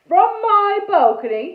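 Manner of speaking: loud, high, indignant cut-in; booming interruption. A narrator's reading. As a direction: cut in loudly as an affronted ghost. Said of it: "he interrupted".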